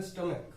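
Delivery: स्टमक with a hard k sound at the end, said softly; स्टमक said as stum-uk, ending in k; pronunciation demo. Shown as a demonstration. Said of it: "क्या इसका pronunciation करते हैं".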